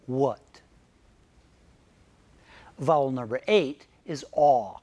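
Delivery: ordinary talk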